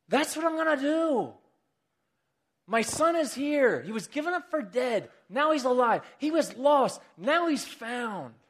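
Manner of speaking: high-pitched, elated proclamation; overjoyed father. Acted. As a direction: announce the son's return with soaring joy. Father celebrates his lost son's return.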